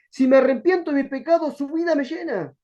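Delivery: ordinary talk, nothing marked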